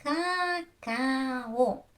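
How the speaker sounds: "Cacao" as three long separate beats, ka-ka-o; split into syllables. Shown as a demonstration. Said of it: "So we divide".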